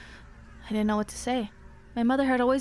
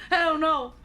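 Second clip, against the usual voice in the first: ordinary talk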